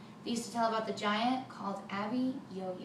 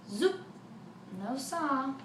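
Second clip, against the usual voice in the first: plain reading voice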